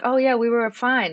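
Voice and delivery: airy pretend "we were fine"; mock breezy. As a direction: play it light as if all was fine. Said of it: "I might sound like".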